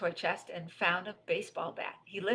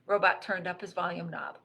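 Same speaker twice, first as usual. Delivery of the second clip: ordinary delivery, nothing marked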